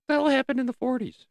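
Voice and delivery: fast, high puzzled outburst; exasperated question. Real, not acted. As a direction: throw the question up in disbelief. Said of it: "exasperated question".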